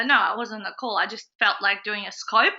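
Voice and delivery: ordinary talk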